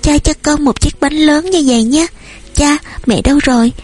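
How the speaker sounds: put-on high small-child voice; hungry toddler. A narrator's reading. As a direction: beg daddy like a little one. Hungry toddler begs father.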